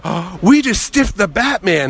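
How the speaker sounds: high, slow gasped disbelief; reenacted dismay. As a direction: stretch it out in mock horror. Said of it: "And I go".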